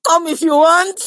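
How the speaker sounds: high, loud laughing dare; laughing challenge. Acted. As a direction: dare them while laughing. Mock threat, laughing.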